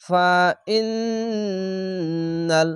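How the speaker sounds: "ফা ইন্নাল" with a long hummed double n; very slow, sustain the n inside "ইন্নাল"; obligatory ghunnah. Shown as a demonstration. Teacher demonstrating ghunnah, n in "ইন্নাল" hummed long. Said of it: "এখানে ওয়াজিব গুন্না".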